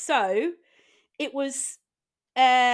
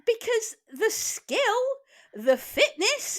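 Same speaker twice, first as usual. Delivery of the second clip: gushing; 10 semitones higher, slower than usual. Real, not acted. Gushing about Riverdance.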